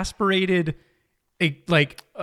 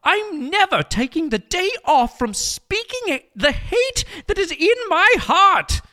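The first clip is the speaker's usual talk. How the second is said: high-pitched put-on British author; J K Rowling; 11 semitones higher than usual. Rowling answers her exasperated agent, high British voice. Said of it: "I will be J K Rowling".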